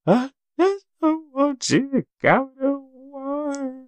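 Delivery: put-on high, slow, whimpering little-girl voice; sobbing child's plea, stretched out and high; imitating crying daughter. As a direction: mimic a tearful four-year-old begging daddy to stay.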